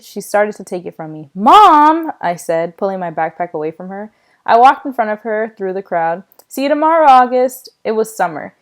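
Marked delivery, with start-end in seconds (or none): 1.3-2.1 loud, high cry of protest; exasperated protest. Acted. Son objects to mother taking his backpack, exasperated protest. 6.5-7.7 loud, high called-out goodbye; friendly call. Acted. Classmate calls goodbye to Augie in the crowd, friendly call.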